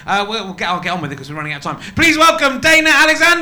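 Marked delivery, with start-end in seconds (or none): 1.9-3.4 loud, high announcer's build-up; big, raised-pitch welcome shout; stage introduction. Real, not acted. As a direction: call the act onto the stage. Compere calling act onto stage.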